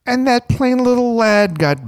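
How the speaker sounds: very high, loud exaggerated voice; put-on commentator voice. Shown as a demonstration. Reader giving the bored listener voices, hammed-up commentator. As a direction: overdo it as a high-pitched commentator. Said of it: "Oh, you need voices for me".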